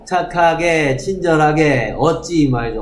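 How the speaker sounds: ordinary talk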